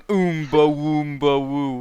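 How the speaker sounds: mock mumbo-jumbo chant; lightly sing-song burbling, played for laughs; imitated lip-flapping nonsense. Imitating a guy walking along making sounds, silly burbling. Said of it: "flapping his lips and going".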